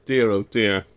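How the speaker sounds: ordinary talk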